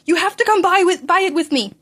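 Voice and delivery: high, loud insistent plea; needy high plea; eager begging. Acted. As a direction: push him to come along, almost whining. Begging friend to come along.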